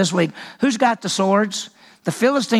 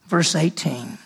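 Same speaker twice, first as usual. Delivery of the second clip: usual speaking voice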